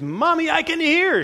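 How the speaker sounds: put-on high, excited cry of a child; thrilled, high-pitched shout to mommy; excited exclamation. A narrator's reading. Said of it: "she exclaimed".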